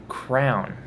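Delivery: "crown" for crayon; kids' pronunciation. Shown as a demonstration. Mimicking kids these days, crayon said as "crown". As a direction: say crayon as one syllable, "crown". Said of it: "kids these days".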